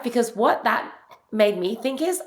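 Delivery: ordinary talk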